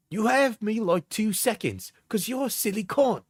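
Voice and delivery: mocking voice. Mock partner message.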